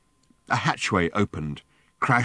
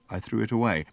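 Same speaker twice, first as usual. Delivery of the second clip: plain reading voice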